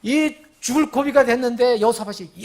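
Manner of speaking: ordinary delivery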